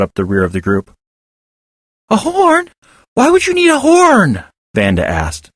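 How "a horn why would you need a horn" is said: put-on surprised child. A narrator's reading.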